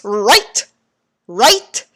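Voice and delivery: usual speaking voice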